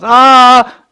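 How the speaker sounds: sudden high, loud anguished wail; Krishna's loud sobbing cry. Acted. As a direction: burst out in a wailing cry. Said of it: "He's crying".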